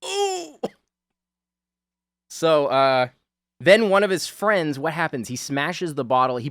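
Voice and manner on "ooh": high, squeaky pained "ooh" after a hit. Retold or imitated. Retelling the movie guy's reaction, high pained ooh. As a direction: yelp it like a guy just hit in the nuts. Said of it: "He goes".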